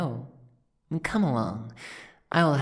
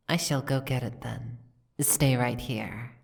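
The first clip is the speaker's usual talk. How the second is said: ordinary talk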